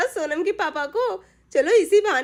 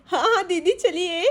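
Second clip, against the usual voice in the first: normal talking voice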